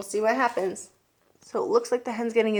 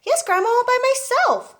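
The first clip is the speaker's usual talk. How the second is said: put-on proud boy calling; 6 dB louder than usual.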